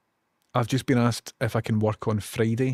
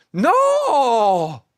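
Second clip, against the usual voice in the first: high, loud anguished cry of no; crying shout; 11 semitones higher, 8 dB louder than usual. Retold or imitated. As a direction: howl it like a crushed child. Retelling childhood heartbreak.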